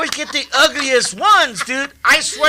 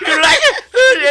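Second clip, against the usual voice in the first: teasing high voice; 12 semitones higher, 7 dB louder, slower than usual. Retold or imitated. Mimicking pedicurist's question.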